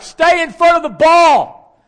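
high, full-force coach's shout; yelling. Retold or imitated. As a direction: holler it across the softball field at a player. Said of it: "like, yelling at her".

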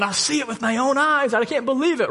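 high, amazed husband, lightly voiced; a hint of tearful wonder, pitched high; husband crying out. Husband watches his wife healed, lightly voiced high amazement. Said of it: "Her husband starts crying and going".

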